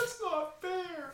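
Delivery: high, slow squeaky whine; silly whiny voice. Pretend. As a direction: whine like a sulky cartoon kid. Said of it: "happy and sad about our teams".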